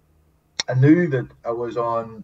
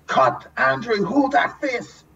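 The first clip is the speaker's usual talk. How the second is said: calling cut; 11 semitones higher, slower than usual. Retold or imitated. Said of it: "he just goes".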